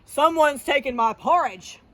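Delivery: big, loud bellow; Papa Bear. Acted. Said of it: "bellowed in his big, deep voice".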